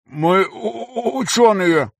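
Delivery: high, stammering robotic voice; distorted robotic stutter. A narrator's reading. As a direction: stutter through a distorted mask. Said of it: "ответил тот искажённым голосом, напоминающим роботизированный".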